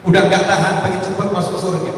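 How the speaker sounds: normal talking voice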